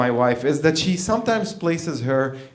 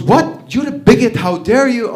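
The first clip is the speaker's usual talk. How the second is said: high, loud indignant retort; mock outrage; 7 semitones higher, 5 dB louder than usual. Wife scolds husband for blaming the gays, mock outrage. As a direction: imitate a scandalized scolding.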